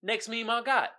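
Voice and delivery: ordinary delivery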